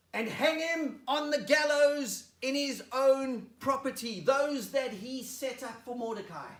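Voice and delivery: high, loud, slow command; furious, drawn-out shouted decree; shouted order. Acted. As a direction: bellow the sentence to your men. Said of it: "He shouts to his, his men".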